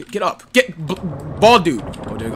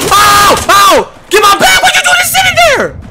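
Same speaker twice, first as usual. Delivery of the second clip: exasperated yell; 10 semitones higher, 5 dB louder, slower than usual. Real, not acted. Yelling at idle teammate.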